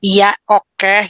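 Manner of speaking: put-on grudging kid giving in; sulky child. A child reluctantly giving in to mom.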